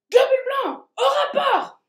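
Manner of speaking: high, loud military shout; shouted command. Acted. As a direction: bark the call to report. Army roll call shout.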